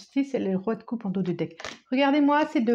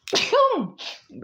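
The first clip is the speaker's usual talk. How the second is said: real sneeze, high burst; 11 semitones higher than usual. Real, not acted. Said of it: "Je vais éternuer".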